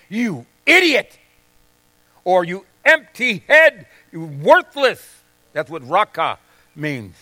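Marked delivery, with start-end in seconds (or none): 0.1-5.2 slow, loud demonstrated insult; mock insult. Preacher showing congregation what raca means, slow and loud. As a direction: spit out the insult slowly. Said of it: "It would be like saying".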